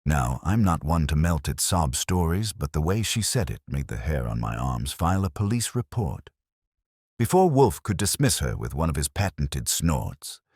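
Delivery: usual speaking voice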